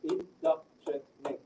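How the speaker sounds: slow "indoctrinate" in four separate beats, in-doc-tri-nate; syllable by syllable. Shown as a demonstration. Student answers examiner's syllable question, "indoctrinate" slowly syllable by syllable. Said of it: "Now that is four syllables".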